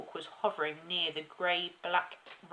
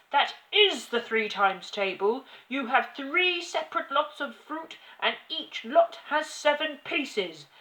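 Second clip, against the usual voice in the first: loud, raised, emphatic scolding; shouting tyrant; 5 semitones higher, 11 dB louder than usual. A narrator's reading. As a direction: berate the child, drawing out each insult. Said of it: "shouted the Trunchbull".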